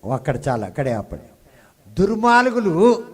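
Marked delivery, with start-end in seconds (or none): 1.9-3.0 దుర్మార్గులు said higher, louder and slow with a rising end; దుర్మార్గులు sung out slow and rising for them to repeat; model word. Shown as a demonstration. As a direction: lead the congregation with దుర్మార్గులు, drawn out. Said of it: "చెప్పండి".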